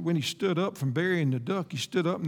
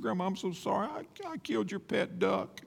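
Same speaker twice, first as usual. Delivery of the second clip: put-on soft, high, a touch of tearful confessing boy; higher and much softer, like a sorry child near tears; lightly voiced crying boy owning up; crying confession; 5 semitones higher, 6 dB quieter than usual. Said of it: "he was crying".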